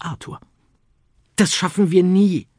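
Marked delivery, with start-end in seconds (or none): 1.4-2.5 much higher, louder despairing cry; a boy losing hope. Acted. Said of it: "meinte er verzweifelt".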